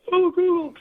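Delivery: high, loud gushing squeal; swooning girls squealing. Retold or imitated. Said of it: "And they'd all go".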